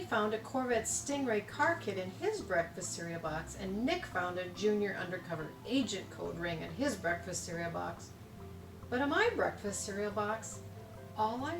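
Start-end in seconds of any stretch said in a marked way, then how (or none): none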